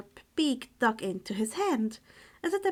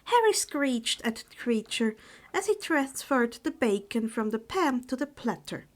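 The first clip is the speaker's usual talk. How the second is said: plain reading voice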